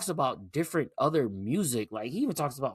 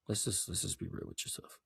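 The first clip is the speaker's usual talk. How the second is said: whispered aside; 9 semitones lower, 14 dB quieter than usual. Real, not acted. Confiding aside to the viewer.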